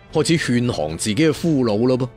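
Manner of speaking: ordinary delivery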